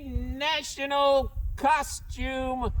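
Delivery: muffled behind a mask; through a face mask. Real, not acted. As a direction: speak through a costume mask. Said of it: "so hard to talk through this mask".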